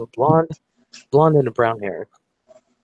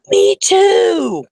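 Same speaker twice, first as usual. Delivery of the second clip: very high, loud squeal; thrilled shriek; 7 dB louder than usual. Retold or imitated. Retold girls learning of shared home state, thrilled shriek. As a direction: squeal it like an overjoyed new friend.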